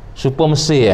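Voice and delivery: normal talking voice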